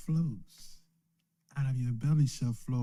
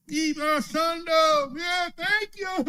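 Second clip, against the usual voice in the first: high, slow, hammed-up greeting; mock churchgoer; slower than usual. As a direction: parody a sugary churchgoer. Mocking shallow church talk.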